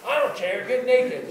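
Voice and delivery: acted loud impatience; insistent mock shout. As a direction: imitate a mogul who will agree to anything. Mogul brushes off objection, louder.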